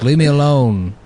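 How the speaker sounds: lightly voiced tough-guy actor; a hint of a movie hard man; Charles Bronson. Host playing young Bronson to teasing kids, light impression. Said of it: "I was doing the Charles Bronson voice".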